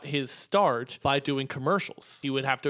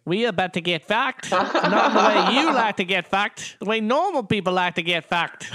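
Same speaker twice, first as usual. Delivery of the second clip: put-on Kiwi accent; 5 semitones higher, 6 dB louder than usual. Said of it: "in the Kiwi New Zealand accent".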